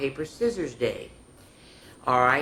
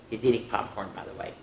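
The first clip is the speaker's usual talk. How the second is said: ordinary talk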